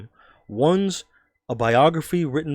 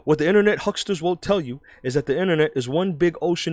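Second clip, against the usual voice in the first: higher-pitched New York columnist; New York accent; 4 semitones higher than usual. Acted. Journalist warning about internet hucksters, New York accent. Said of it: "I'm gonna do a New York accent".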